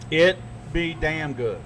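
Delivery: usual speaking voice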